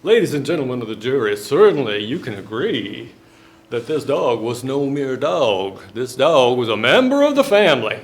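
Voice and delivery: loud Southern lawyer pleading to the jury; Mississippi lawyer accent. Acted. Dog owner's lawyer pleads to the Mississippi jury, Southern accent. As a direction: lay on the Mississippi charm, fast and loud. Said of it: "this is what people sound like in Mississippi".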